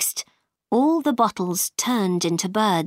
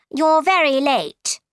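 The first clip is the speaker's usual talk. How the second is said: a higher-pitched, mildly scolding queen; reproachful queen; 5 semitones higher, 4 dB louder than usual. A narrator's reading. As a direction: lightly scold a latecomer, pitched up. Red Queen scolds late Alice.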